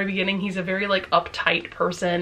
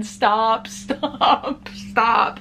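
teary laugh; 3 semitones higher, much slower than usual. Real, not acted. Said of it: "I'm gonna start crying again".

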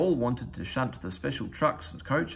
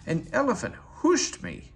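higher, lightly voiced indignant engine; cross hiss; 10 semitones higher than usual. A narrator's reading. Henry cross after the elephant soaked him, higher sulky complaint. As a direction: spit it out, offended. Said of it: "he hissed".

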